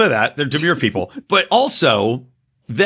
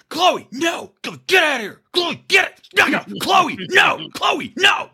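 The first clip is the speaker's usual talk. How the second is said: high-pitched frantic commands, not full volume; re-enacted squawking reprimand, pitch way up; retold dog scolding; 12 semitones higher than usual. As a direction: imitate yelling at a misbehaving dog. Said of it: "thinks that I'm screaming".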